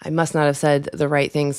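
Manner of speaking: ordinary delivery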